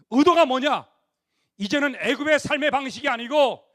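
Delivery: fiery preaching. Acted. Preacher declaring God's way to Israel, fiery. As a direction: drive the point home to the crowd.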